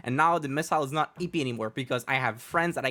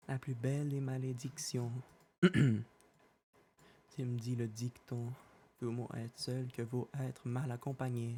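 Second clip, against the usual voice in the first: dramatic recitation; 10 dB quieter than usual. Acted. Quiet dramatic French recitation.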